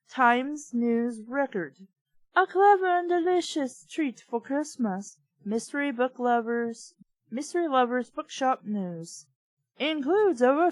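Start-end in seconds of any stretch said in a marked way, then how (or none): 2.4-6.9 higher, bright promo read of the book's praise; blurb read. Acted. Reader reciting the book's review quotes in an announcer tone. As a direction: sell it like a back-cover ad.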